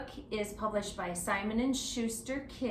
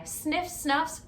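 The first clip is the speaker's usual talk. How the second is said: plain reading voice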